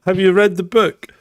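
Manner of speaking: normal talking voice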